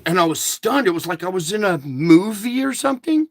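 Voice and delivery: ordinary talk, nothing marked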